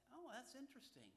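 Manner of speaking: high-pitched, intrigued "oh"; lifted voice of pretend discovery; acted pleasant surprise. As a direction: play a listener suddenly intrigued. Acts out won-over questioner.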